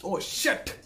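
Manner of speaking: sharp, loud, airless "ओ शिट" like being pinched; startled yelp. Retold or imitated. Teacher acting out being pinched to show the shocked fourth tone, startled yelp. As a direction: yelp it as if someone just pinched you. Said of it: "मैं उसको कहूँगा".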